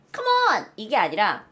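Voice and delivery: usual speaking voice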